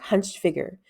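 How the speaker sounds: plain reading voice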